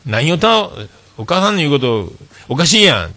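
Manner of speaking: lightly acted Hakata mother scolding her kid; Hakata dialect retort. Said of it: "博多弁で".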